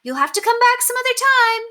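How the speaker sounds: busy mother calling down to the paperboy. A narrator's reading. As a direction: call down over the noise. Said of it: "Miss Kelly called down".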